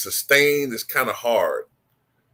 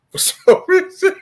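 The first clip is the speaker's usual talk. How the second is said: laughing; 7 dB louder than usual. Real, not acted. Laughs mid-word.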